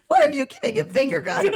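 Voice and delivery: high, loud laughing question; laughing sister. Retold or imitated. Sister mocking the finger guns, laughing high. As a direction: laugh it out in disbelief. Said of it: "My sister goes".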